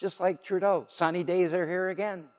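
ordinary talk

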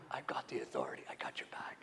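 low soft whisper; whispered reassurance. Acted. Jesus reassuring the grieving mother, low whisper. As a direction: lean in and reassure.

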